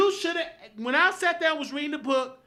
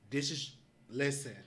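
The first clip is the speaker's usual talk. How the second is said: ordinary talk, nothing marked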